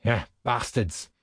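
slow, contemptuous aside; drawn-out disdain; sneering. A narrator's reading. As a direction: sneer it without raising your voice. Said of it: "Zapp sneered".

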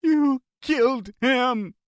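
high, slow wailing cry; unhinged grief; hysterical accusation. A narrator's reading. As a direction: stare him down and wail it. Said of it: "for the dozenth time".

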